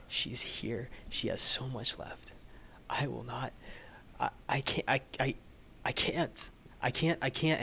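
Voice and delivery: rising into whispered desperate pleading; grieving denial. Acted. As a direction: plead through the tears, voice failing. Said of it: "he's like".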